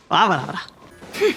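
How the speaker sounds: ordinary talk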